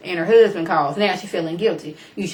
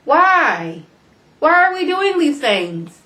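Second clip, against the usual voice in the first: exasperated disbelief; 7 semitones higher, 3 dB louder than usual. Retold or imitated. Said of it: "I was like".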